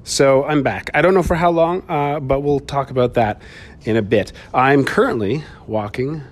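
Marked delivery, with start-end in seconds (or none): none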